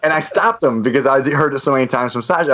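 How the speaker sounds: normal talking voice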